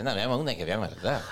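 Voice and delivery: mock how everyone proposes a film; mock typical person. Mocking a date proposing a rewatch, low lazy mumble. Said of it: "me pasa siempre".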